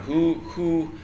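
normal talking voice

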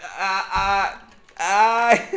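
high, loud stammering "ah, ah, ah"; strained hesitant groan. Retold or imitated. Daughter about to say sorry, straining, high and loud. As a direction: choke on the first word of a hard apology. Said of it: "You're like".

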